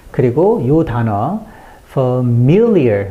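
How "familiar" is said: slow "familiar" with a strong, long "mil"; stress on MIL. Shown as a demonstration. Teacher shows the word stress, heavy "mil" in "familiar". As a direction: punch the middle syllable of "familiar". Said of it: "mill의 강세가 있습니다".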